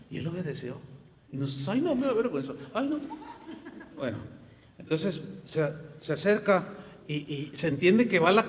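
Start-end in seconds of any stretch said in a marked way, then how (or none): 1.7-3.0 higher, whiny, laughing; mock embarrassment. Preacher mocking believers too shy to witness, laughing whine. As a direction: giggle like a shy excuse-maker.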